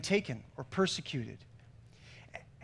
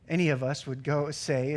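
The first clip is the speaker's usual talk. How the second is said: usual speaking voice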